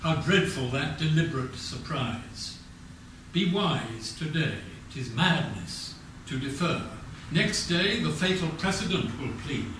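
usual speaking voice

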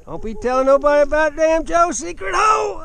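usual speaking voice

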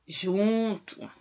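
ordinary delivery

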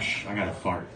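low hushed whisper to himself. Retold or imitated. Kid in class test whispering to himself, hushed. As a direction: keep it down, nobody can hear.

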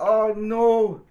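high, loud storybook dismay; mock alarm. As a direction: play up the alarm for a child. Acted alarm after shark shout.

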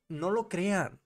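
ordinary talk

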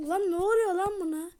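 usual speaking voice

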